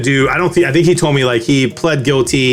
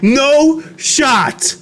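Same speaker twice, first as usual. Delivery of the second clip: high-pitched incredulous exclamation; can't-believe-it reaction, pitched way up; stunned disbelief; 11 semitones higher than usual. Acted. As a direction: let the voice leap up in amused disbelief. Retold shock at seeing the photo.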